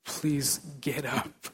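slow, soft pleading; soft earnest plea. Real, not acted. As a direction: beg them gently to get up. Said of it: "I plead".

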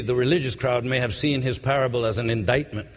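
usual speaking voice

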